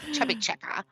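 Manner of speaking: put-on Bette Davis style mid-Atlantic accent. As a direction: say it like an old Hollywood star. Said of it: "Like a mid-Atlantic accent".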